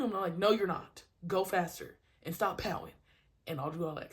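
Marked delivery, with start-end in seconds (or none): 0.4-2.9 loud, insistent contradiction; firm coach. Retold or imitated. Coach answering a pouting kid, firm and loud. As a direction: shut down the excuse and push.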